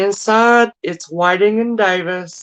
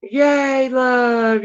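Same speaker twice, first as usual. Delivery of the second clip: high, drawn-out "yay"; happy cheer; 6 semitones higher than usual. Real, not acted. Seller congratulating the winning buyer, happy cheer.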